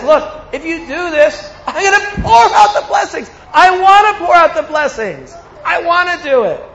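eager God. Acted. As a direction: voice God bursting to pour out blessings. God voiced, eager to bless.

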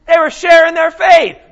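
ringing preacher's shout; emphatic shout. Real, not acted. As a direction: proclaim it to the room. Preacher's answer to own question.

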